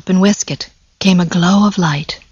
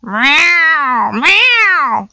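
higher meows, each falling; cat meowing; 7 semitones higher than usual. Acted. Simpkin interrupting the mice at the door, meowing. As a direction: mew like an impatient cat. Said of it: "interrupted Simpkin".

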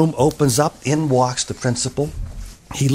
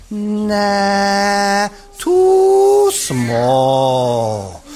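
long high "nah", then drawn-out swoop down; comic doctor stretching every vowel; dismissive doctor; 5 semitones higher, much slower than usual. A narrator's reading. Doctor rejects the needle.

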